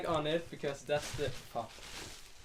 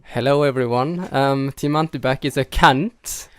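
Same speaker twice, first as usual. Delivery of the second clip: mock announcement; 11 dB louder than usual. Co-host booming a fake announcement to the listeners. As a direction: boom it out like a grand announcement. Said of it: "while [name] says something random".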